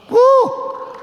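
high exultant woo; a preacher's happy woo; joyful whoop. Real, not acted.

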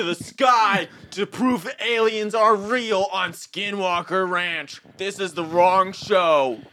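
high, loud, slow alien conspiracy rant; excited TV host. Acted. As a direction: proclaim it like a wild TV conspiracy host. Ancient Aliens host parody.

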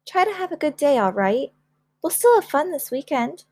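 high, lilting reassurance; encouraging mother. Acted. Mother dropping her child at school, high and encouraging. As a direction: cheer up a disappointed child.

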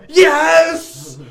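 high, loud, triumphant "yes"; triumphant shout. Real, not acted. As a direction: yell it in victory. Triumphant answer.

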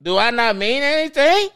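exasperated, rising to a yell; mock-angry rant at crybabies; mock outrage. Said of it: "And I'm like".

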